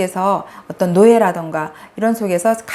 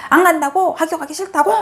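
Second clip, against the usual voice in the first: higher, mock-indignant scolding; irritated mother; 7 semitones higher than usual. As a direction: snap back at the child in disbelief. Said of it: "또 이렇게 얘기를 하죠". (어이없어 다그치는 엄마 흉내)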